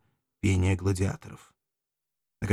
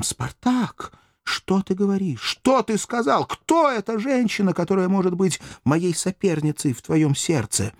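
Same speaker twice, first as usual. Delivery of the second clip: much higher, jealous challenging cry; defiant outcry; 11 semitones higher than usual. Acted. Valeria confronts Spartacus about a rival woman, high defiant cry. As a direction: demand it, high and stung with jealousy. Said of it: "вскричала вызывающим голосом".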